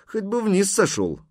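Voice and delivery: high-pitched mild reproach; stern. A narrator's reading. Horseman chiding the boy for not dismounting, stern. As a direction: scold him lightly from up high. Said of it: "строго спросил синеглазый Хузарин".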